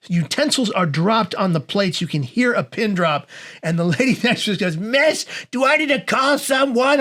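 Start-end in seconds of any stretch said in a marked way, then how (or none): 4.8-7.0 high, drawn-out meddling concern; alarmed busybody. Retold or imitated. Lady at next table offering help after the scream, alarmed. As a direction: butt in high and slow, full of alarm. Nosy diner butts in again.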